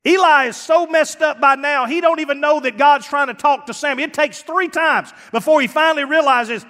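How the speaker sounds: loud and high, driving it home; preaching at full force. Real, not acted. Preacher raising his voice to the church about Eli. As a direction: hammer the point to the congregation.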